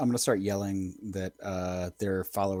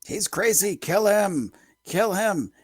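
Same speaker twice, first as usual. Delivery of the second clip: yelling; 7 semitones higher, 4 dB louder, slower than usual. Acted. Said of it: "I'm gonna start yelling".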